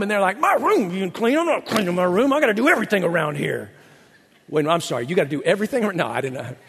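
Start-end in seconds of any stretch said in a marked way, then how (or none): 0.4-3.7 a hint of a whiny teen talking back; mock the kid protesting the chore; lightly voiced indignant teenager; sassy teenager. Mock teenager refusing to clean.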